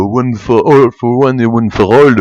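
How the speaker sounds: put-on slow, drawn-out English accent on the motto; mock English accent. Mocking film musketeers' English motto, slow English accent. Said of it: "des gens qui parlent avec des accents anglais".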